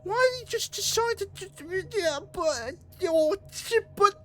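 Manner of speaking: very high squeaky voice; panicked squeak. Real, not acted. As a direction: squeal it out in disbelief. Squeaky aftermath of chase.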